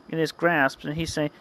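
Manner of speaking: plain reading voice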